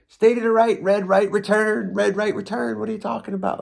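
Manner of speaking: high-pitched comic back-and-forth; mock flustered argument. Son reciting buoy rules to puzzled dad, mock argument. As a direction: bicker it high, like a flustered crew. Acted argument over channel markers.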